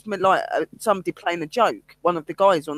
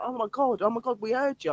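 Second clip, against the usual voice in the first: higher, softer mock astonishment; imitated amazement; 5 semitones higher, 6 dB quieter than usual. Medium retells colleagues telling her they heard her, amazed. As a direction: act out awed colleagues, pitched up.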